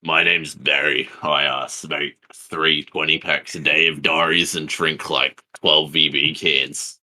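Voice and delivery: slow Queenslander bogan introducing himself; Queensland Aussie accent. Acted. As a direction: drawl it slowly in broad Australian. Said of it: "You're too good at that accent".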